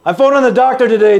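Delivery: high, loud and fast, calling out the news; excited shout. Acted. Brother shouting his news down the phone to Danny.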